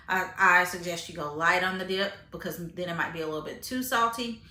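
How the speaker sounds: usual speaking voice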